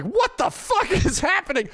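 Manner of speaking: squeaky mock panic with a laugh; shocked disbelief. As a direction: blurt it out as the car dies under you. Car dies on the freeway.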